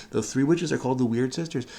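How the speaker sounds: usual speaking voice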